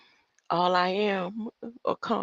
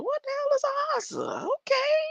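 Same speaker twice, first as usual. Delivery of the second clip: baffled. Retold or imitated. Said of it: "I was like".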